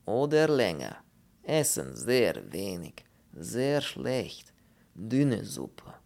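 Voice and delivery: slow, heavy foreign voice in broken German; slow Polish character voice. Acted. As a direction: drag the words out like a doleful Pole. Pole laments in broken German.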